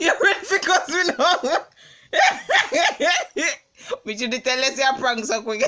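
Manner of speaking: loud words broken by high cackles; laughing. Real, not acted. Prankster teasing the pranked partner, laughing hard. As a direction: crack up while teasing.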